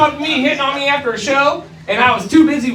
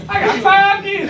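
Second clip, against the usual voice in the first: high, slow mocking echo of the waitress; lightly voiced waitress echo; waitress quote again; 10 semitones higher than usual. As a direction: repeat her line higher and slower. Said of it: "That's not a no".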